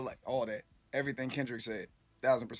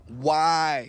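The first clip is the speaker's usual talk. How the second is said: exasperated loud why; 4 semitones higher, 10 dB louder than usual. Real, not acted. Reacting to fight news.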